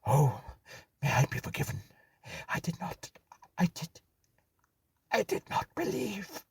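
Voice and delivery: high, broken pleading, fading to breath; babbling moan. Acted. Dead man pleading for clemency, broken babbling. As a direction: beg for forgiveness, sobbing out the words. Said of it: "babbling words".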